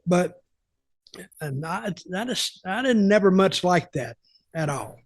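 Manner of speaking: ordinary talk, nothing marked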